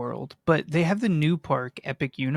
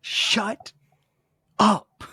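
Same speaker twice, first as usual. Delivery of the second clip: mock yell; 8 semitones higher, 4 dB louder than usual.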